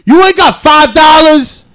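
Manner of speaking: high, pushy imitated yell; shouted begging. Voicing the stranger begging for money.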